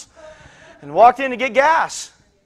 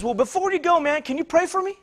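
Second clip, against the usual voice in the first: higher, lightly voiced asking; tentative retold request, raised pitch; man's request; 8 semitones higher, 3 dB quieter than usual. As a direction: ask it pitched up, as the retold stranger. Man asks him for prayer.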